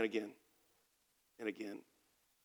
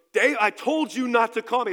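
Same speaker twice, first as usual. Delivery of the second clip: higher and louder, an irritated warning; retold angry snap; 6 semitones higher, 5 dB louder than usual. Friend shouting down the phone at Dave, irritated and louder. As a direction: bark it like a fed-up man cutting off a caller.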